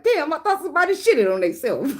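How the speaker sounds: high voice, laughing while quoting; laughing coworker quote. Retold or imitated. Coworkers reacting to the fart spray, high and laughing. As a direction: mimic coworkers cracking up. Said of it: "Everybody bust out laughing like".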